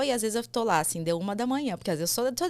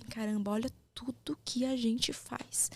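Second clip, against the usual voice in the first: whisper; 4 semitones higher, 7 dB quieter than usual. Real, not acted. Confiding aside about her tiredness.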